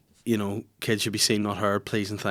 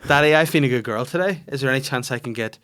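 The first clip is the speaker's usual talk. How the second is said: imitating daughter; 6 semitones higher, 5 dB louder than usual. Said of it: "She goes to me".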